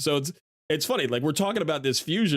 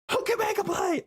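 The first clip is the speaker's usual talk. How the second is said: very high mock scream; yelled across the street. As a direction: holler across the road at a star. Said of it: "scream at him across the street".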